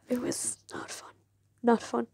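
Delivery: very soft, voiceless aside after a sigh; sighing whisper. Real, not acted. Fan recalling the last episode, sighing whisper. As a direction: let it out under your breath. Sighing over a miserable memory.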